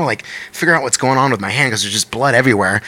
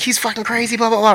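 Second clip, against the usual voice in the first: high, mildly frantic retelling; imitated panicked friend; 11 semitones higher than usual. Friend Molly fleeing her husband, mock panic.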